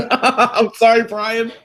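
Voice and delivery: can't hold it in, giggle through "sorry"; laughing apology. Real, not acted. Player laughing at his own chowder joke, apologising through laughter.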